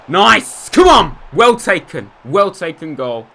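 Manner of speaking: high, very loud goal cheer, easing off; celebratory shout. Real, not acted. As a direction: burst into a real shout, then settle. Celebrating a goal just scored.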